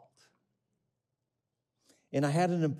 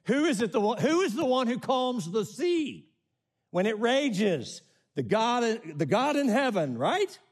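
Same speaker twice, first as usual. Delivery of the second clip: higher and louder, slow fervent preaching; preaching at full force; 11 semitones higher, 4 dB louder than usual. Acted. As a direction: lift it up and proclaim who calms the sea. Preacher builds to a climax.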